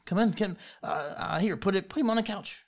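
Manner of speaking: put-on high, loud bustling old woman; a fussing granny giving orders; flustered old lady. Old lady directing the party to the couch, high and flustered.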